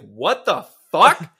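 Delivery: slow, loud, stretched out what the fuck; a crowd's baffled outburst; mock outraged yell. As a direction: drag it out in shocked disbelief. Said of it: "Everyone's like".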